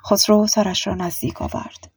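plain reading voice

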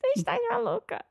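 high, quick put-on European Portuguese accent; parroting her husband's Lisbon impression, high and fast; mock European Portuguese. Wife mimicking the husband's fast, high mock European Portuguese accent. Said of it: "A voz ficando mais fina".

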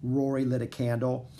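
plain reading voice

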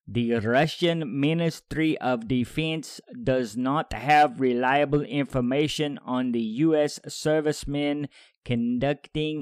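slow, deliberate put-on Russian accent; amateur Russian accent, drawing out each phrase. Podcaster reads the ministry's quote in a put-on Russian accent. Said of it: "do this in a Russian voice".

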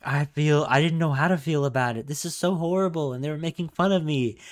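retold plaintive complaint; mimicked complainer. Mimicking upset woman's complaint.

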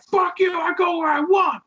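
put-on high, shouted drunken defiance; belligerent. Imitating a drunk refusing the rental worker's directions, belligerent. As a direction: play the raging drunk who won't be redirected. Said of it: "drunken, belligerent asshole".

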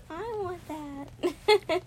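high, very slow, tearful; high, slow crying want; tearful longing. Retold or imitated. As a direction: stretch it out through tears. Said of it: "And I was like".

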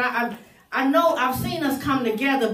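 usual speaking voice

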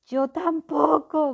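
slow, loud, high shout; dwarf yells it from the water; frantic cry for rescue; yelling for help. Acted. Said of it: "gritaba el enano".

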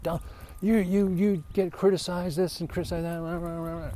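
high, mocking imitation of his wife's rant. Husband mimics wife fired up about the sermon, mock high rant. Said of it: "She's like".

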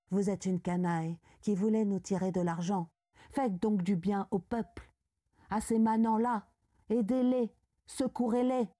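ordinary delivery, nothing marked

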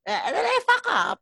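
says it through a high laugh. Real, not acted. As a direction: giggle while confessing it. Laughing at own mistake.

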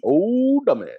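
put-on "Old" stretched high, then dropping; high, drawn-out jeer; mocking echo. Co-host echoes the nickname, high mocking drawl.

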